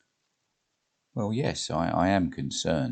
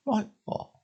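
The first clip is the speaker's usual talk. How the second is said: Jane's voice. Acted. Jane curious.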